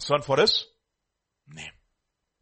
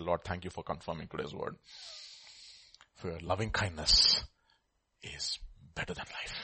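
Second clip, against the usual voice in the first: much softer, fading to a breath; hushed prayer; 6 semitones lower, 8 dB quieter than usual. Retold or imitated. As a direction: whisper the thanks.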